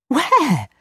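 put-on high, eager girlish cry; urgent cry. A narrator's reading. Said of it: "cried Gerda".

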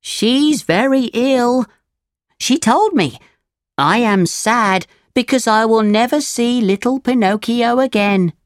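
high cricket voice relaying sad news; Jiminy Cricket, sad news. Acted.

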